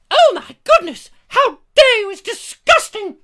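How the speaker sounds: very high, loud mock indignation; outraged passenger. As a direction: squeal it as a scandalised passenger. Voices an outraged car passenger.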